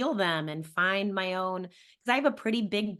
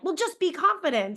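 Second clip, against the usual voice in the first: high, louder, dismissive pep-talk voice; breezy mock advice; 10 semitones higher, 5 dB louder than usual. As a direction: toss it off like empty cheerleading. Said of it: "slapping a big, like".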